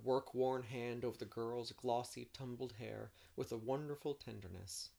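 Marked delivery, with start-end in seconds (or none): none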